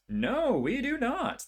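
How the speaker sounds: high, drawn-out dismay. Real, not acted. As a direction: pitch leaps up on a slow, exasperated no. Second failed roll, louder dismay.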